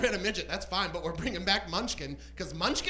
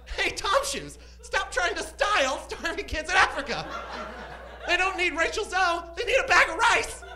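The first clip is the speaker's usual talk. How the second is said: high, loud comic exasperation; mock scolding; 9 semitones higher, 6 dB louder than usual. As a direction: tell them off in hammed-up indignation. Mock scolding of shoe company.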